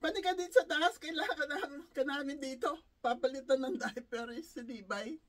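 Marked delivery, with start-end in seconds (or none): 0.0-2.7 higher, louder retold call; imitated shouting. Man of the house calling caregiver upstairs, imitated shout. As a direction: call out urgently, imitating the employer. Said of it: "sumisigaw na yung lalaki".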